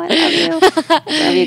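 high gushing fangirl voice. Acted. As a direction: squeal your love. Gushing at Brene.

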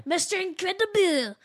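mock French "Mister Incredible!"; a bad French accent, exclaimed; hammed-up French accent; poor French accent. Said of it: "that's a very poor French accent".

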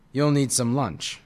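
ordinary delivery, nothing marked

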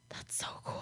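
gasping awed whisper. Real, not acted. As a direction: breathe it out in wonder. Gasped awe at snow news.

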